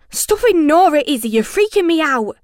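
high, loud, fast outburst; freaked out. A narrator's reading. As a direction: yell it in rattled panic. Frightened boy yelling at Izzy.

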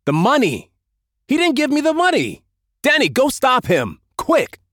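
very high, urgent exclamations; frantic outburst. A narrator's reading. Leader sends Denny after the money, frantic. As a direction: cry out in sudden panic over cash.

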